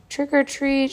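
high, sing-out doorstep call without real volume; mild shout for a trick-or-treater; storybook shout. A narrator's reading. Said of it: "shouted a scary witch".

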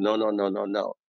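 ordinary talk

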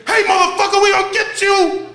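high yelled taunt from a passing car; mock threat yell. Thug yelling at the jogger from a car, loud taunt.

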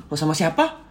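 lightly lifted "Oh, sama siapa?"; casual asking. Shown as a demonstration. Partner just asking who the speaker was with, casual. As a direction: ask with simple curiosity, no accusation. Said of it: "cuma nanya".

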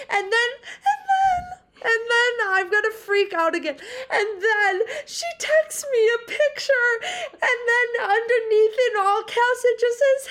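high, tearful excited crying. Real, not acted. Telling her co-host about the tickets, crying happily. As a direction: voice breaking into happy tears. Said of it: "when I tell you I started crying".

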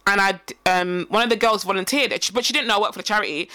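ordinary delivery

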